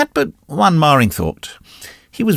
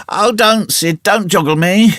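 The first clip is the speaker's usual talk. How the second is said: hammed-up sickbed moan; mock moaning; 7 semitones higher than usual. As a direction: moan it like a faker. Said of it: "Tom moaned out".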